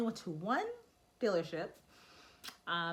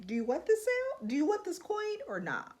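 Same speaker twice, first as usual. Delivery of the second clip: exasperated; 11 semitones higher, 4 dB louder than usual. Retold or imitated. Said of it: "it's just like".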